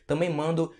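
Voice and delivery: usual speaking voice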